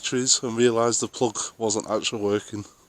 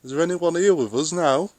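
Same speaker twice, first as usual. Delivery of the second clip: calling out; 7 semitones higher, 5 dB louder than usual. Real, not acted. Calling out to a spirit.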